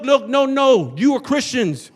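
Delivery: usual speaking voice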